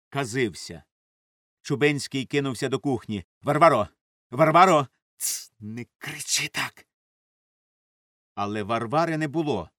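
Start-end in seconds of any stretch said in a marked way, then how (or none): 3.4-4.9 loud call for the maid; urgent loud summons. A narrator's reading. Calling the maid toward the kitchen. 5.2-6.9 soft, slow shush; hushing. A narrator's reading. Hushing him after the loud call.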